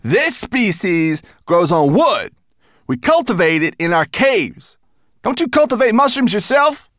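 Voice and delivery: high, loud, measured character voice; cheery mountain creature, explaining. A narrator's reading. Berber Chan's character voice.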